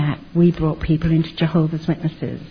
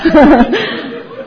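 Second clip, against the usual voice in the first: high, loud burst of laughter; 9 semitones higher, 7 dB louder than usual. Real, not acted. Laughs at son's retort.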